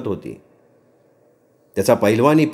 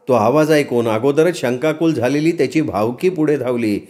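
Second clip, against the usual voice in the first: plain reading voice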